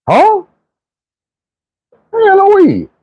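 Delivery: high, feigned innocent denial; squeaky fake innocence; mock innocent. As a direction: act like you know nothing. Playing dumb about weed.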